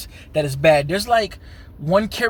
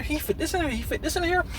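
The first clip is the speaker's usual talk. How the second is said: high, soft incredulous questions; acted-out amazement; 11 semitones higher, 6 dB quieter, faster than usual. Viewer nodding along at the film's cultural touches, amazed. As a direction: pitch jumps way up, marveling softly.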